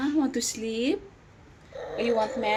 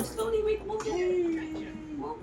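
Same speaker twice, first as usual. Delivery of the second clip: much higher and softer; 7 semitones higher, 7 dB quieter, much slower than usual. Real, not acted. Coaxing the baby.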